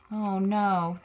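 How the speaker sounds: ordinary delivery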